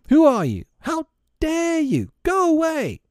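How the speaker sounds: very high-pitched fairy outrage; indignant cry. A narrator's reading. Tink disturbed by Nibs knocking, very high indignant cry. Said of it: "she cried".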